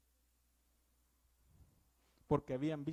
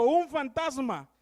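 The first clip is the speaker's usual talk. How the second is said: loud, high, slow cry of fear; frightened cry; 7 semitones higher, 12 dB louder, slower than usual. Acted. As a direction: cry out as a terrified disciple. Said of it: "y dieron voces de miedo".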